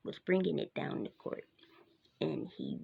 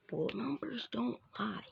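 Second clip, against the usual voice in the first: ordinary delivery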